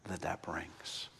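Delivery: whispered, dropped to a breath; hushed. Acted. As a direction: let the line fall to a whisper. Preacher drops to a hush.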